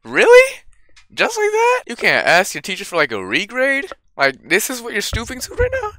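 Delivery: high, incredulous sarcasm; mock disbelief. Gamer mocking the game's story, incredulous sarcasm. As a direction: scoff at the premise in a squeaky voice.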